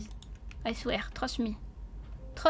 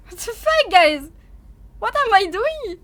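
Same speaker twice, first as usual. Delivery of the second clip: loud, high exasperated outcry; baffled outburst; 5 semitones higher, 10 dB louder than usual. Real, not acted. Streamer baffled by the failed recipe, exasperated outcry. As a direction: exclaim in loud bewilderment.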